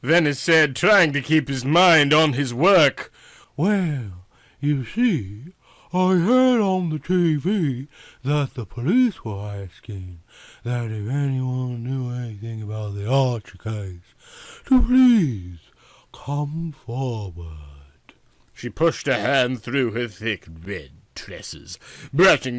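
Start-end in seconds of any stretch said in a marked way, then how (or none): 3.6-15.6 Yvonne's voice. A narrator's reading. 16.1-18.1 slow whispered come forward; breathy whisper. A narrator's reading. Yvonne whispering to the detective, seductive.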